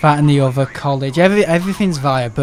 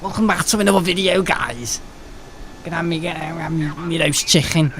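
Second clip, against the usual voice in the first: lightly imitated Scouse vlogger; hint of a mocking Liverpool voice; mock Liverpool accent; 2 semitones higher, 5 dB quieter than usual. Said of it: "He lives in Liverpool".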